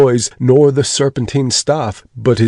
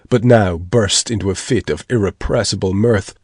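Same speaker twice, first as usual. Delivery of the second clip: plain reading voice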